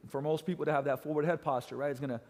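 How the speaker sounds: ordinary delivery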